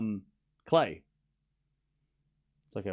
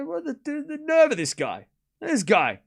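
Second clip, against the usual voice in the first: pitched up in disbelief; high mock outrage; 10 semitones higher than usual. Real, not acted. Outraged at the yawning man, pitched high. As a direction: sputter in mock indignation.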